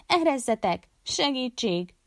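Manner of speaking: high cry for help; narrated scream, not at full volume; shrieking. A narrator's reading. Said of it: "sikoltotta Bárzsut".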